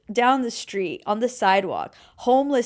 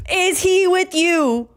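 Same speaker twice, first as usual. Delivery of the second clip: high, slow, drawn-out quote; mimicked incredulous question; 8 semitones higher, 4 dB louder, much slower than usual. Quoting the girls at the register, mimicked incredulous question. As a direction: stretch it out in mock disbelief. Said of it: "They said".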